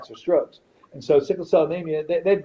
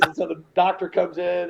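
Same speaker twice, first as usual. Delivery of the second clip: ordinary delivery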